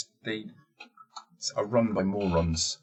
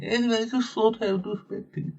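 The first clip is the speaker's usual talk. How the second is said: much higher, put-on posh voice; posh lady voice. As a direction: play it like a grand lady being polite. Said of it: "she's very posh".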